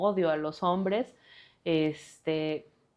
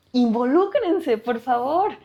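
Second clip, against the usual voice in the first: raised pitch, insistent appeal; emphatic plea; 7 semitones higher, 4 dB louder than usual. Real, not acted. Woman urging men to get involved, emphatic plea. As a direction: implore them, stretching the first word.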